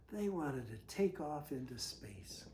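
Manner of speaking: hushed whisper. Acted. A parent whispering the story to a child. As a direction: breathe it like a secret. Bedtime read-aloud, hushed.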